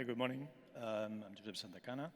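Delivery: ordinary talk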